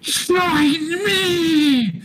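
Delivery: very high shouted Spectre cry; frustrated demand. Acted. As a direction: scream the demand in rage. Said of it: "in frustration he looks at you and is like".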